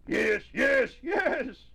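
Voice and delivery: loud, high excited shouts; gleeful exclamations, pitch climbing; jubilant. A narrator's reading. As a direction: shout it out in delight. Character delights in a new word.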